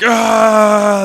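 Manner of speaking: high, loud charging yell. Acted. Damien running down the street, loud high yell. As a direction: let out a battle cry while running. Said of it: "He's like".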